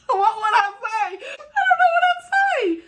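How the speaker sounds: very high laughing squeal; real helpless laughter. Real, not acted. Stumped at telling people nope politely, helpless high laughter.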